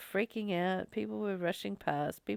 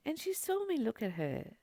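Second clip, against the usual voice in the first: usual speaking voice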